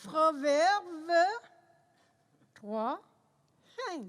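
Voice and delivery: talking around a pencil; pen in teeth. Real, not acted. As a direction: pencil clenched in mouth. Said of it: "avec un crayon dans la bouche".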